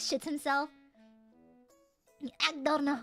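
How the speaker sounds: ordinary talk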